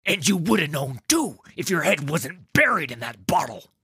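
bickering guard. Acted. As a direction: lay into a fellow guard, loudly. Guards bickering over a card game.

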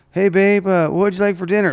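high, bright mock-sweet asking; sweet girlfriend voice. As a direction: play the doting girlfriend. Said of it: "when she's like".

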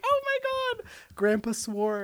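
put-on high gasping child voice; a shocked grandkid; shocked kid. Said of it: "and you'd be like".

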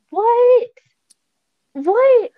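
squealed high, loud What; high delighted disbelief, twice over; a stunned, thrilled double take; astonished. Real, not acted. Amazed at the friend's answer.

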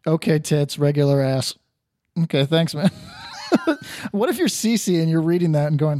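usual speaking voice

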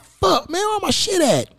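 high panicked searching; high frantic where my shit at; mock panic. Retells panicked search for nose ring.

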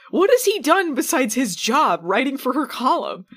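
ordinary delivery

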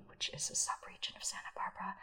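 breathy whisper, dropping away to almost nothing. Pretend. Reading the vineyard notes to listeners in a hushed whisper. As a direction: whisper the tech sheet like a secret. Reads tech sheet in a whisper.

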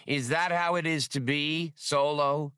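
a hint of a hypnotic hiss; mesmerizing. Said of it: "came Ul Rashan's hypnotic voice".

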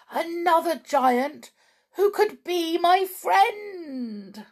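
hope for a new friend; excited giant. Acted. Giant's hopeful thought.

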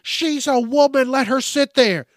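high, loud voice of nagging onlookers; imitating onlookers who take the woman's side; mock bystander.

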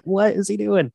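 high, baffled question; mock disbelief at what he's doing; a lightly acted, disbelieving reaction to the boy; incredulous. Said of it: "I was like".

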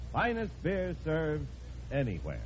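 normal talking voice

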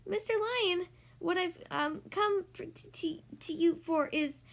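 high, halting and nervous; stammering mouse. Acted. Mouse stammers his request to lion, high and nervous. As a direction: stumble over the words.

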